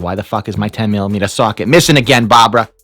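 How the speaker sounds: put-on shouting at Barbara in Boston accent; furious Boston yell at his wife; angry yell. Yelling at wife over socket.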